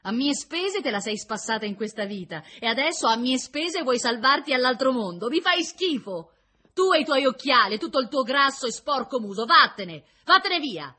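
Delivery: loud, high tirade of disgust; furious yelling. A narrator's reading. Prisoner jumping up to drive the prince away, screaming in fury. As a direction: build to a shouted dismissal, hurling each insult. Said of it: "urlò".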